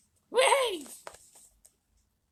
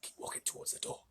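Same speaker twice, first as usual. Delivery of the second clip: soft urgent whisper; 14 dB quieter than usual. A narrator's reading. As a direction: breathe it low to Charlie. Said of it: "he whispered".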